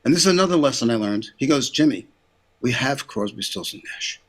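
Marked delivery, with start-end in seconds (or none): 1.7-4.2 soft, low, patient explanation; quiet put-down. Retold or imitated. Label boss correcting his young producer, quiet put-down. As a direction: let it drop lower and softer, almost under the breath.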